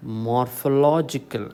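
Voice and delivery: morphological said slowly as morpho-LO-gical; stress on LO. Shown as a demonstration. Teacher showing where stress falls, slow morpho-LO-gical. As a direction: put the weight on LO in morphological. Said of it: "The stress falls on".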